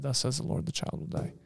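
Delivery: quiet whisper of doom; hushed divine verdict. Retold or imitated. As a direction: breathe it out like a grave whisper. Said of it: "God said".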